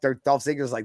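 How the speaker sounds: ordinary talk, nothing marked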